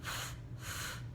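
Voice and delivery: breathy unvoiced f, f; teacher modeling the ph sound; letter sound f. Shown as a demonstration. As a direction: blow out the f sound. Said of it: "P H".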